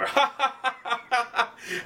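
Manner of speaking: high, hammed-up staccato cackle; Arnold Schwarzenegger cackle. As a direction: imitate a big mocking cackle. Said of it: "deaf, old Arnold Schwarzenegger cackle".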